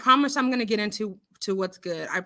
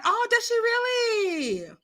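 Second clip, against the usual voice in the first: very high, drawn-out squeal; exaggerated surprise; 12 semitones higher, slower than usual. Real, not acted. Host reacting to a viewer's comment, exaggerated surprise. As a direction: stretch it out way up high.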